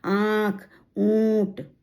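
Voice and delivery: आँख and ऊँट with drawn-out nasal vowels; teacher sounding out nasalized vowels; nasal vowel demo. Shown as a demonstration. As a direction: send the vowels of आँख and ऊँट through nose and mouth together. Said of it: "ना और माँ की मिली जुली आवाज".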